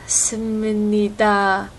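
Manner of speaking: "Sumunida" drawn out slowly with an extra u between s and m; slow wrong pronunciation. Retold or imitated. Imitating a student's mistake: "Sumunida" slow, with the extra u. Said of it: "she can't say it".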